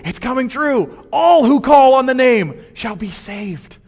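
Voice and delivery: high, loud, ringing proclamation; rousing call for an amen; fired-up preaching. Real, not acted. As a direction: preach it at full force to the room. Preacher exhorts congregation at full force.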